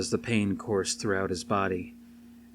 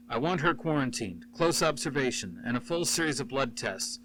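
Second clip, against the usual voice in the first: higher, distinct commanding official; cold boss giving orders; 4 semitones higher than usual. A narrator's reading. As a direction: issue orders with clipped authority. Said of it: "a voice that was maddeningly familiar".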